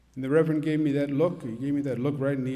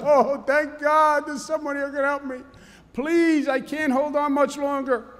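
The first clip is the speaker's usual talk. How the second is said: desperate plea; 9 semitones higher than usual. Acted. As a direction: beg heaven from a cliff branch. Falling man relieved, pleading.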